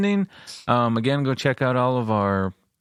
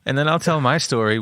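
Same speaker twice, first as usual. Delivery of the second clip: ordinary talk, nothing marked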